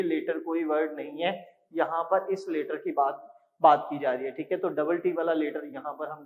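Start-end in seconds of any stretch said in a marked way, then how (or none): none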